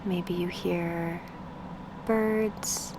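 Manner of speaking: unhurried meditation guide, with long pauses between phrases; guided meditation. Acted. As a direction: ease the listener into stillness, spacing out each instruction.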